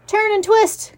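high goose honk. A narrator's reading. Goose coaching the fleeing pig, high honking call. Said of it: "honked the goose".

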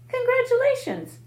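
ordinary delivery, nothing marked

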